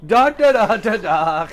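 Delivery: loud, high mock ranting in nonsense syllables; imitating a freak-out. Podcaster mimicking the actor's on-set outburst, freaking out. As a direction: shout gibberish as a hammed-up tantrum. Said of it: "Him freaking out".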